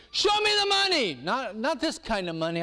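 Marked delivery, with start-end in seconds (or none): 0.1-1.1 high call. Acted. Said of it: "God's saying".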